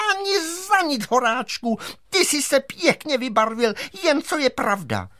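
very high, indignant teacher cry; screaming teacher. A narrator's reading. Teacher held in the giant's palm refuses, screaming. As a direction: shriek a defiant refusal. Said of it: "soudružka učitelka křičela".

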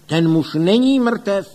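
slow, loud, high proclamation; big sonorous cry, drawn out; resonant shout. A narrator's reading. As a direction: announce it in a broad ringing voice. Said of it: "hlasem k neuvěření širokým a zvučným".

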